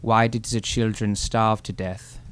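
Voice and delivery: deadpan German joke teller; German accent. Acted. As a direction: stiff German punchline delivery. Hans tells his joke.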